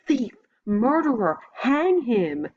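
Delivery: shrill accusing yell, pitched high. A narrator's reading. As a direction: hurl the accusations at him. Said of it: "she yells".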